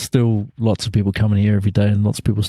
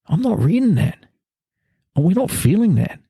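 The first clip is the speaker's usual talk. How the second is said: higher, offhand mock voice; breezy friend; 8 semitones higher than usual. Friend in Melbourne shrugging off the New Zealand news, in a higher offhand voice. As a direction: toss it off lightly, unbothered. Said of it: "he goes".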